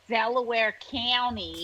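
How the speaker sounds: slow Delco accent, County as "Cany"; Philly-suburb accent with a squashed County vowel; Delaware County accent. Shown as a demonstration. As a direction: say it like a Delco local, drawn out. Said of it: "learn the Delaware County ... accent".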